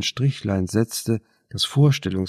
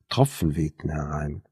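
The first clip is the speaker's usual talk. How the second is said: plain reading voice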